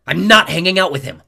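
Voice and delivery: loud shouted denial; girl denying she's friends with the enemy; shouting denial. A narrator's reading. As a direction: yell it back at him. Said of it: "sworn enemy".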